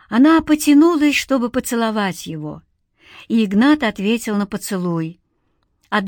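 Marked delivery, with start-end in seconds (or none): none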